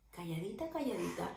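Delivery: very soft, hushed tiptoe voice. Acted. Teacher telling of Susana sneaking down, soft hushed voice. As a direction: say it like sneaking down quietly.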